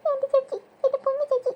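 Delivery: hammed-up childish crying; acted wailing. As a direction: wail for a lost sister. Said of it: "കിടന്ന് മോങ്ങല്ലേ".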